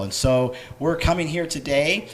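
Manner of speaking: usual speaking voice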